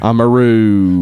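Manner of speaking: put-on slow, drawn-out Australian accent. Co-host plays a kangaroo, slow Australian accent. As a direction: stretch "roo" in mock Australian. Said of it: "working on this Australian accent".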